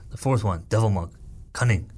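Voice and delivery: understated, matter-of-fact villain roll call; deadpan introduction. Retold or imitated. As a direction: list it off deadpan, pausing before the last word. Quotes a solemn introduction of villain.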